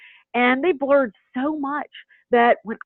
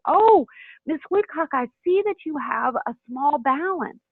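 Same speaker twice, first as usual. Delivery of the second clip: sweet, higher, gently friendly scheduler voice; kindly; 5 semitones higher than usual. Shown as a demonstration. Scheduler kindly telling a patient about her balance, sweet and high. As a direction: coax the patient kindly and brightly. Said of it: "just very kindly say".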